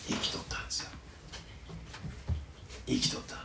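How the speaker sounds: unvoiced whisper; hushed reveal. Real, not acted. Preacher revealing Jesus lived, whispered. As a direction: let the wonder be whispered.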